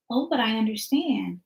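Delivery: quietly, evenly understanding; soft calm reply. Retold or imitated. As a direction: say it low and unbothered. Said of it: "You keep coming to me saying".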